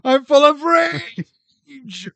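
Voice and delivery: high, loud, slow mock monster cry; hammed-up villain wail. Ringwraiths discovering the hobbits gone, howling slowly. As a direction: play a furious ringwraith, stretched out. Voicing Nazgul in the film.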